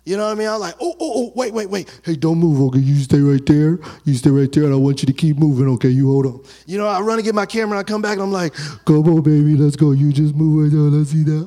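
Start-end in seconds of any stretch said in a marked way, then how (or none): 0.8-6.4 put-on loud urgent pleading to the baby; excited dad. Father telling baby to keep moving. 8.9-11.5 put-on loud urgent pleading to the baby; excited dad. Father urging baby with camera.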